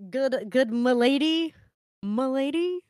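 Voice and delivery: slow, smug mock neckbeard voice; nasal smug neckbeard. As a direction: play the cringey fedora guy. Mocking fedora neckbeard bit.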